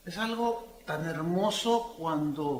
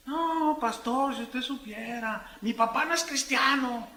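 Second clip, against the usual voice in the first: put-on higher, pleading teenager; teen's voice; 6 semitones higher than usual. Teenager tells the pastor about his father, pleading. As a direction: play the hurt teen confiding.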